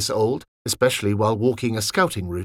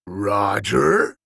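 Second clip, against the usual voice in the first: put-on lower, rough-edged old soldier; gravelly voice; 4 semitones lower than usual. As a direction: rasp out the name, rising. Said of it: "in a voice that was quite gravelly".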